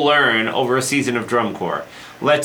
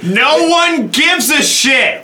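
dismissive shout; 10 semitones higher, 4 dB louder, slower than usual. Real, not acted. Dismissive shout at DCI winners.

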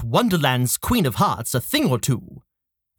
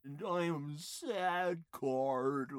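sad card voice; 2 semitones lower, 17 dB quieter, slower than usual. Acted. Card sulks, very soft.

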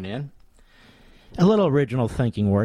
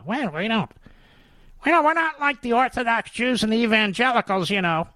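higher, smug put-on voice of a leftist; mocking leftist; 11 semitones higher than usual. Radio host parodying leftists who boast about no morals, higher smug mock voice.

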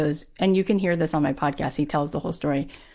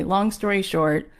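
ordinary delivery

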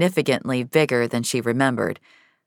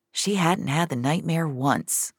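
ordinary delivery, nothing marked

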